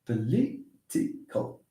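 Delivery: "Political" slowly split, po-LI, ti, cal; soft, spaced-out syllables of "Political". Shown as a demonstration. Teacher counting syllables for the class, "Political" slowly broken up. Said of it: "this one is on the penultimate".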